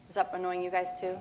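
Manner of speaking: normal talking voice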